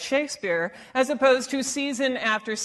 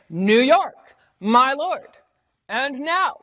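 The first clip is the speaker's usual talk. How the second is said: "New York, my lord, and now", each second word punched; two-beat units, weak then strong; iambic stress; 4 dB louder, slower than usual. Shown as a demonstration. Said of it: "we speak iambically in English".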